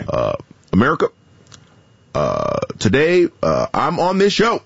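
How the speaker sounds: halting presidential impression with long "uh" pauses; hesitant, measured statesman cadence; Barack Obama. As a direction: imitate a president, phrases swooping up after each pause. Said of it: "I have a pretty decent Barack Obama".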